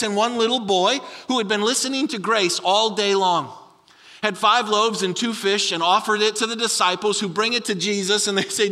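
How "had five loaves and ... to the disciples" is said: ordinary talk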